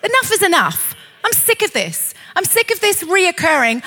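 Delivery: high, fed-up protest; indignant and resolute; defiant declaration. Acted. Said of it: "you need to rise up and say".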